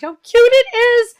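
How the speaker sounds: excited gush. Real, not acted. Gushing over a card.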